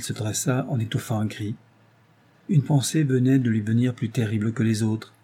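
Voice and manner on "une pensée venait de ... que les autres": ordinary delivery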